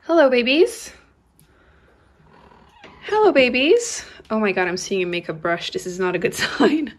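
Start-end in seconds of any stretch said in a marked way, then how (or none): 0.0-4.0 cooing hello to her kittens. Real, not acted. Greeting kittens.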